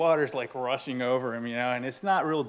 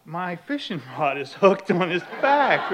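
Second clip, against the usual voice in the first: ordinary delivery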